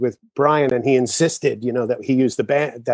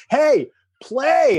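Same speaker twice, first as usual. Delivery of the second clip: urgent yell; 13 semitones higher than usual. Retold or imitated. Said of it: "Paul Shaver's going".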